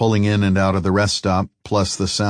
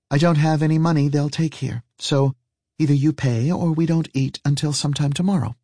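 higher female character voice; matter-of-fact woman companion; 8 semitones higher than usual. Acted. Woman telling her companion he must pay, higher-voiced and matter-of-fact. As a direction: explain it patiently in her higher voice.

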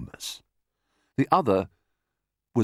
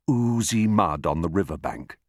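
ordinary delivery

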